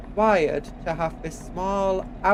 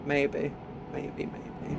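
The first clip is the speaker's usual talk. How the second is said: whisper; 3 semitones lower, 9 dB quieter, much slower than usual. Real, not acted. Teasing aside after joke.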